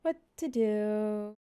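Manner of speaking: slow, flat mock line reading; bad acting impression. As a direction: stiff wooden movie delivery. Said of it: "That's a great impression".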